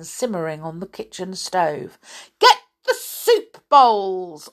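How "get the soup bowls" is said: loud, slow command; old woman ordering. Acted. Old woman ordering the children to their bowls, loud. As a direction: snap the order out. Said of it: "ordered the old woman".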